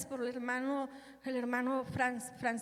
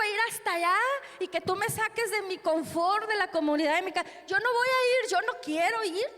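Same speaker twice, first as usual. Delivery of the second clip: Jonás rebelde; 7 semitones higher than usual. Retold or imitated. Predicadora imita a Jonás enojado.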